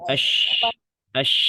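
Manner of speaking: a teacher drilling the letter shin with sukun; hushed sh sound. Shown as a demonstration. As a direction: sound the sh of "ash" as a broad steady rush of air. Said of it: "tengah lidahnya dia".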